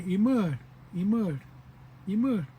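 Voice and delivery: Imur clearly, for the class to repeat; model for repetition. Shown as a demonstration. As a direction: say Imur three times for them.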